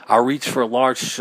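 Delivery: ordinary delivery, nothing marked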